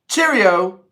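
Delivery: high, loud hammed-up Cheerio; a cheesy British sign-off; bad British accent. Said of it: "That's so bad".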